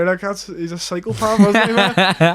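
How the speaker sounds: laughing, drawn out slowly. Real, not acted. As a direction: laugh through the words. Laughing at Khabib's violence.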